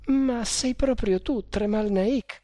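put-on high-pitched girl's voice, wondering; incredulous girl. A narrator's reading. Girl to her rescuer, incredulous. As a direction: ask it high, hardly daring to believe.

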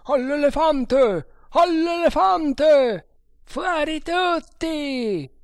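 high, drawn-out full shouts; shouted alarm. A narrator's reading. Men in the bungalow call everyone out, shouting. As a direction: raise the alarm with long, stretched cries. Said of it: "s'alzò un gran gridio".

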